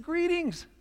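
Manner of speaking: Jesus greeting the excluder, high and cheery. Acted. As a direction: greet him like an old friend. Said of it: "He just said".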